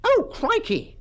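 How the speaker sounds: very high schoolboy dismay; alarmed gasp. A narrator's reading. Bunter faces the cane, high alarmed gasp. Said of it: "gasped Bunter".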